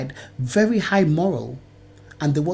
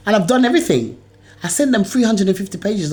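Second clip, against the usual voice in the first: higher and louder, fed up; exasperated outburst; 6 semitones higher, 5 dB louder than usual. Real, not acted. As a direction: push it out in real frustration. Angry rant about documents.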